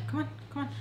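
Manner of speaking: high, soft come on; quiet beckoning. Retold or imitated. Mother quietly calling her aside to talk. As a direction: coax her aside softly.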